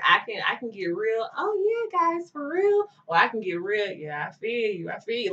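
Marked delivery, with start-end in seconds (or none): 1.4-2.9 put-on high, bright valley-girl switch; chameleon voice. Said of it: "I'm a chameleon with it". 3.9-5.3 laid-back voice. Retold or imitated. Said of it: "I'm a chameleon with it".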